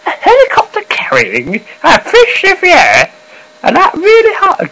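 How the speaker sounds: very high, squeaky mock newsreader, with a laugh; high-pitched imitation of an early news read; old high news voice. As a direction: squeak the headline way up high like a kid reporter. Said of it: "very first YouTube news service was like".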